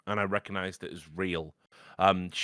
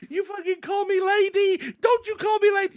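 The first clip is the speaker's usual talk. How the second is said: shrieking, much higher and louder, imitating an enraged woman; furious high shrieking; 6 dB louder than usual. Pharmacist offends the dying man's wife, she shrieks in fury. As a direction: screech it out like the furious wife in the film. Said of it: "she goes on this".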